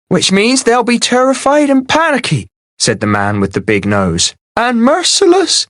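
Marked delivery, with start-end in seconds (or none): 0.1-2.5 high, thin anxious man; a nervous scrawny villager pleading. A narrator's reading. Big-nosed man voiced high. 4.6-5.7 high, thin anxious man; a nervous scrawny villager pleading. A narrator's reading. Big-nosed man voiced high.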